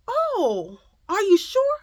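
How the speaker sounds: high, doubting mock voice; imitated nosy woman. Nosy shopper doubting a mother, mockingly imitated. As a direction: mimic her doubtful question up high. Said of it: "She was like".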